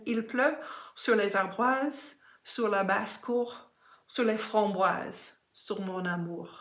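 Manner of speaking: French poem read aloud in a French pronunciation; lightly recited French verse. Shown as a demonstration. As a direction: recite the lines "Il pleut sur les ardoises" with French vowels and the French r. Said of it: "it says".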